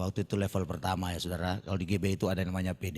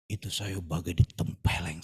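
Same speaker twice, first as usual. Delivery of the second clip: ordinary talk, nothing marked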